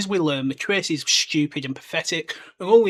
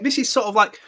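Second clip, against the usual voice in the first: normal talking voice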